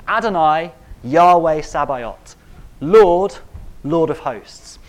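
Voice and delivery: ringing loud proclamation of God's names; Isaiah crying out the names of God, loud and grand; proclaiming Isaiah. Retold or imitated. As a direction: call out the titles like a herald. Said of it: "He says".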